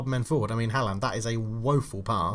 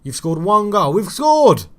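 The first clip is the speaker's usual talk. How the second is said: loud, high burst of joy; surprised cheer; 7 semitones higher, 8 dB louder, slower than usual. Real, not acted. Celebrating an own goal mid-rant, loud surprised cheer. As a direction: switch from rant to shouted celebration.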